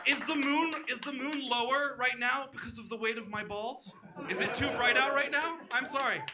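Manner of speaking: hammed-up arrogant strongman; Zelensky. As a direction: boast grandly, laughing at your own brag.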